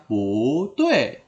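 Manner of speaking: "pu tue" slowly, "pu" rising, "tue" falling; second-tone pu. Shown as a demonstration. Teacher showing the changed tone, "pu tue" rising then falling. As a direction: lift "pu", then drop "tue". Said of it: "Jadi bacanya".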